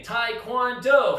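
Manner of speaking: slow, drawn-out shouted taekwondo; taekwondo yell. Shown as a demonstration. Instructor demonstrating the class yell, slow and shouted. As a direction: belt out each syllable slowly.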